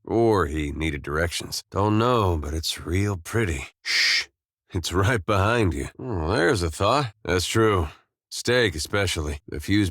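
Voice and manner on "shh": a short hushing "shh"; shush. Acted. Hushing a companion nearby, short shush. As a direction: hush them with one hissed breath. Hushing someone during the scene.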